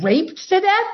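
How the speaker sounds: high incredulous echo, rising sharply; shocked disbelief. Retold or imitated. Wife hearing the dead ducks news, high shocked echo. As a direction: squeak it back in disbelief. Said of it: "And I'm like".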